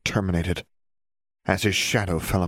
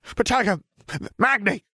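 high, desperate shield spell; frantic, faltering spell cry; exhausted stumbling. A narrator's reading. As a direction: stumble over the incantation, worn out. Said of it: "stumbling over the words as exhaustion".

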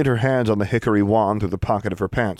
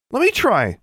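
high, loud, eager plea; bursting to have a turn; 5 dB louder than usual. A narrator's reading. Boy asking for a turn, eager. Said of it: "bouncing on his toes".

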